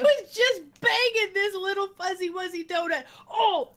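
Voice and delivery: high, giggly retelling; laughing while talking. Real, not acted. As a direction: laugh your way through the words. Cracking up at her own story.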